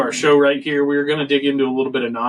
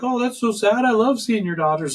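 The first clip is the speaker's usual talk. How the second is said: mock gushing, much higher; imitating the coworker; 9 semitones higher than usual. Employee in line behind dad, gushing much higher. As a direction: gush like a sweet young employee. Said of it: "the girl behind me is like".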